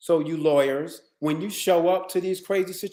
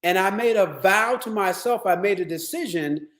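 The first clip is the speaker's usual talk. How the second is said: normal talking voice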